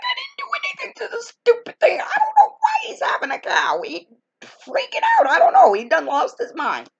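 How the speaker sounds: squeaky exasperated protest. Retold or imitated. Telling her relatives she did nothing to the goose, squeaky exasperated protest. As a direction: shriek it out like a wronged kid near tears.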